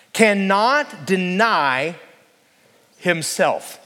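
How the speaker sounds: slow, drawn-out "cannot deny" then sinking on "himself"; weighty emphasis. Shown as a demonstration. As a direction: lean hard on "cannot deny". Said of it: "listen to this powerful statement".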